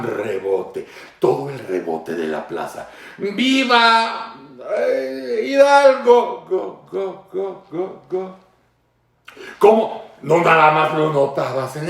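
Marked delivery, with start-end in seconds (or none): 3.2-6.5 drawn-out, ringing "¡Viva Hidalgo!" like from a balcony; hammed-up imitation of a leader's cry; mock presidential shout. 6.5-8.4 soft fading "go, go" like a plaza echo; fading repeats imitating a rebound; mock echo.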